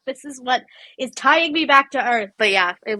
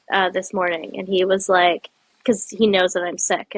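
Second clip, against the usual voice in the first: ordinary talk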